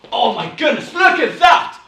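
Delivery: loud, high theatrical exclamation; big high-pitched stage shout; acted astonishment. Shown as a demonstration. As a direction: cry out in amazement to the audience. Acted exclamation while walking the stage.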